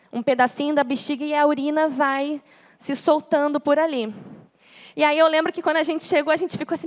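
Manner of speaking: ordinary talk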